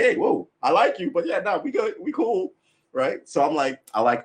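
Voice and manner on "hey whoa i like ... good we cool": high, louder placating guy; nervous backing off. Retold or imitated. Man placating a woman who threatens him, high-pitched. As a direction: back away from a tough woman with a laugh. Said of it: "you're like".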